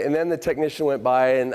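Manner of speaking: usual speaking voice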